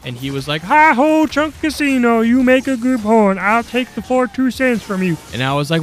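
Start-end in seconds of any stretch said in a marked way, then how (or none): 0.6-5.2 much higher, louder goofy yokel voice. Retold or imitated.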